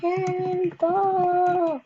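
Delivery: slow drawn-out playful yell; cannonball yell. Pretend. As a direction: stretch out the call. Jumping into pool in game.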